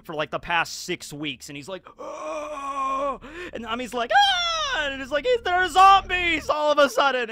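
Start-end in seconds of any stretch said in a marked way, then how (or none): 2.0-3.2 high drawn-out "ohhh" wail; mock zombie cry. Acts out the shriveled man's cry. 4.1-4.9 loud, high-pitched mock scream; Nami. Acts out Nami's scream. 5.3-7.3 loud, high panicked mock shriek; freaking out. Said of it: "everybody kind of freaks out over this".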